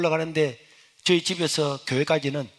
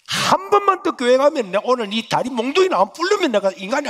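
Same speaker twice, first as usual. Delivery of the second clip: higher, louder threatening vow; angry threat; 9 semitones higher, 6 dB louder than usual. Retold or imitated. Mother threatening her son about church, loud angry vow. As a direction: threaten to break his legs.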